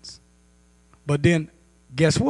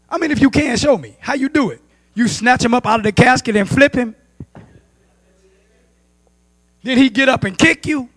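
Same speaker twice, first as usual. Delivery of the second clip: high-pitched, incredulous preacher challenge; animated preaching; 5 semitones higher, 4 dB louder than usual. Real, not acted. Preacher mocking a fight with a dead man, animated preaching. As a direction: challenge them with raised pitch.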